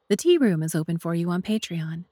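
usual speaking voice

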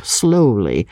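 usual speaking voice

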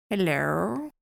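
lightly voiced sly doctor; a drawn-out rising hello; creepy villain voice. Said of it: "I love this voice".

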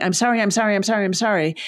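rapid run of repeated apologies; over-apologising. Shown as a demonstration. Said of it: "But if you keep going".

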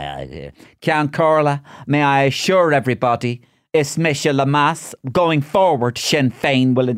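high, stretched parliamentary oratory; declaiming politician. Retold or imitated. Rehearsing a speech to parliament over a sandwich, declaimed high. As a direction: swell into a slow, pompous debating voice.

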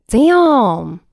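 ordinary delivery

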